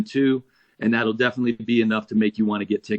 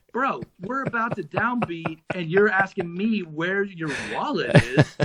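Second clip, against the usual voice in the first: high-pitched mock disbelief; retold exasperation; 6 semitones higher than usual. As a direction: play up the incredulous comeback to a bandmate, pitched high. Retold exasperated reply before downbeat.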